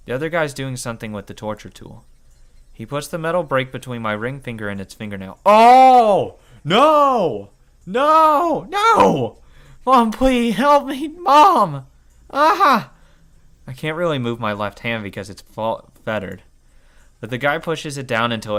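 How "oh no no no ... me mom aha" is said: very high, loud shrieks for help; desperate screams of pain; agonized screaming. Acted. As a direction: scream for mom in agony. Nail being torn off.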